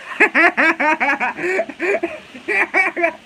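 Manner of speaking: high, loud burst of laughter; laughing out loud at a chat message; big real laugh. Real, not acted. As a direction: crack up hard at the comment.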